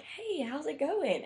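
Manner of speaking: high, drawn-out cheery hello, retold; super happy greeting. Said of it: "super happy".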